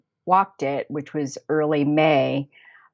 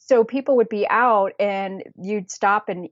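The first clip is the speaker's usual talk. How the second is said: ordinary talk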